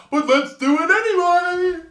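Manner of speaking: high, loud, climbing cry; mock enthusiasm. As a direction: call it out in a rising high-pitched voice. Mocks kangaroos running into traffic.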